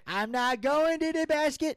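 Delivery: high, loud, fast whine; whiny Gator voice. Pretend. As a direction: whine like a sulking kid. Whiny character refusal.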